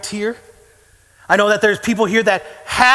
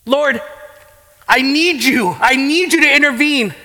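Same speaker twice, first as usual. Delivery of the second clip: raised, urgent plea in a remembered prayer; desperate prayer; 5 semitones higher than usual. Retold or imitated. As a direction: retell the night you cried out, begging. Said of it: "just bawling and saying".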